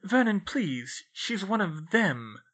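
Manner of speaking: plain reading voice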